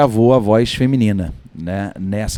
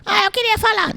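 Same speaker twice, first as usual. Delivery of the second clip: squeaky little sidekick, far higher; tiny cartoon buddy voice. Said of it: "comecei a fazer essa voz assim".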